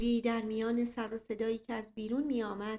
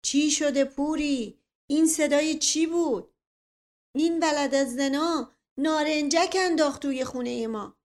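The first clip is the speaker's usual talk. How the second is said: roaring; 5 semitones higher, 8 dB louder than usual. Acted. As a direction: bellow over the noise. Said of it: "صدای نعرهٔ دایجان سرهنگ".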